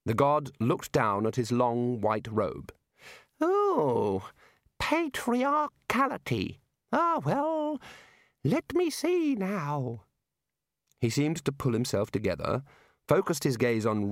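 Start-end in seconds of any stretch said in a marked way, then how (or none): 3.4-10.0 high, slow, fumbling god voice; slowly flustered high deity; flustered little god. A narrator's reading. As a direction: stall for time in a squeaky god voice.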